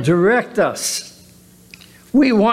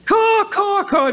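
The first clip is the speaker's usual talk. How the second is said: high mock crow caws; 13 semitones higher than usual. Preacher mocking worldly crows with high caws. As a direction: caw like a crow, pitched way up.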